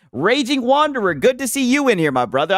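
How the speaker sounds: high, lively welcome; upbeat shout-out greeting. Real, not acted. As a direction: hail the viewer by name. Greeting a chat viewer.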